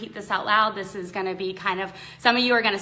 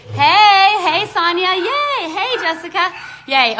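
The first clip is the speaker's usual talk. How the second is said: higher, louder happy hellos; excited greetings; 9 semitones higher, 10 dB louder, slower than usual. Real, not acted. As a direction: greet each arriving friend with delight. Greeting viewers joining live.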